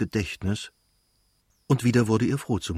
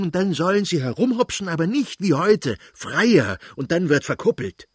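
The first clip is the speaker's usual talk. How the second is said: prince ranting; 6 semitones higher than usual. Acted. The prince berates his wife for matchmaking, furious shouting rant. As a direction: rage at her, voice climbing. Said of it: "brauste der Fürst plötzlich auf und schrie".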